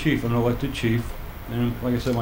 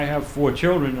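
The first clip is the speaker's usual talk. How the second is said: ordinary delivery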